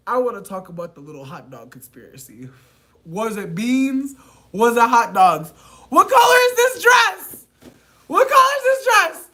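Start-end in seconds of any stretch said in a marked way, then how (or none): 5.9-9.3 shrieked. Real, not acted. Shrieking joke question.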